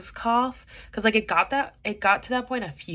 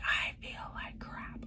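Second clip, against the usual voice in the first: slow, unvoiced, drained whisper; miserable whisper; 10 dB quieter, slower than usual. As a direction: whisper it as if worn out. Retelling feeling ill at work.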